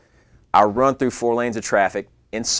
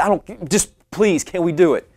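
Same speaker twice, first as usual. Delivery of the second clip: desperate plea; 9 semitones higher than usual. Retold or imitated. Pleading with key cutter.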